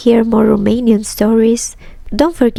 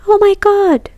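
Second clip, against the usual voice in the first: much higher panicked shriek; terrified scream; 11 semitones higher, 3 dB louder than usual. Acted. Mother sees her son collapse and shrieks much higher in terror. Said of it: "screamed the mother".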